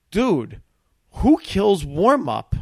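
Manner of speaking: excited praise. Retold or imitated. The host amazed, asking who kills warm-up. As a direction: Jon Stewart marvelling at the warm-up comic. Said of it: "and says".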